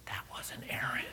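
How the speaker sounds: near-whispered, very soft; hushed revelation. Retold or imitated. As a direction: let the awkward news out under your breath. Son quietly reveals the mistake.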